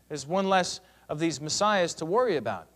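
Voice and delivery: ordinary delivery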